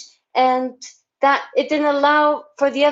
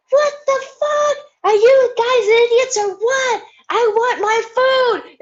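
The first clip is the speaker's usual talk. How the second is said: angry outburst; 10 semitones higher than usual. Retold or imitated. Woman snaps at friends who forgot her order, angry high yell. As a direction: blow up at the guys who forgot her food. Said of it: "it just came out of me like that".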